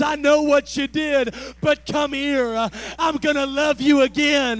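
loud, compassionate pleading; voice of mercy. Acted. Preacher voicing mercy to a sinner, loud compassionate plea. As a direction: call them home with open arms. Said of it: "The voice of mercy has a tone of compassion".

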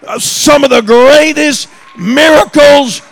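loud, high preaching that swells to a shout; building preaching. Acted. Preacher urging the church to believe in miracles, rising shouted preaching.

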